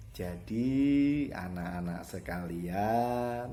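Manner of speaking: dragging, drawn-out words; too slow. Shown as a demonstration. Teacher shows pupils bad pacing, far too slow. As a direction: stretch every word far too long. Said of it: "Kalau terlalu lambat jadi".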